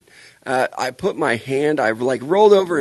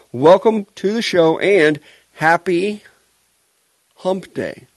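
host greeting; 6 semitones higher, 4 dB louder, slower than usual. Acted. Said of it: "show host greeting".

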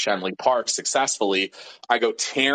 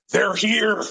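tense warning; 12 semitones higher than usual. Retold or imitated. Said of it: "he just goes".

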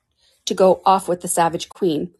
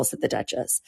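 usual speaking voice

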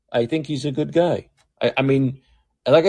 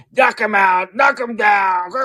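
mock Trump rally bluster, higher and shouted; fake tough guy; 8 semitones higher, slower than usual. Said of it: "Remember the fake tough guy?".

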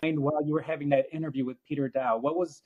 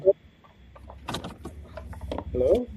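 muffled, bass-heavy line; muffled bass only; 4 semitones higher, 4 dB quieter, faster than usual. Real, not acted. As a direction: voice through a muffled mic. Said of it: "You sound muffled, [name]".

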